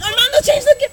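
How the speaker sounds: calling out in a hammed-up panic; mock yell. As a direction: yell across the yard at him. Yelling at kid on bike.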